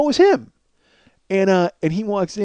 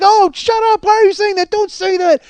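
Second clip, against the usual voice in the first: much higher, embarrassed hushing wife; mock wife voice; 12 semitones higher than usual. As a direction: play the wife telling him to stop. Wife voiced, high pitch.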